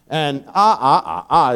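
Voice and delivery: usual speaking voice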